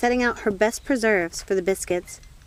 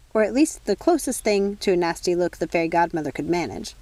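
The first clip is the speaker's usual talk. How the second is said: plain reading voice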